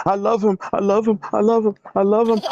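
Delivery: high, mocking sing-song of her crying; mocking whiny quote. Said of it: "Why you crying boo-hoo saying you love this man".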